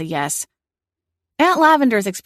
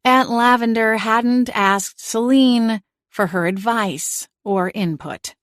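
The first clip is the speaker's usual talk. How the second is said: plain reading voice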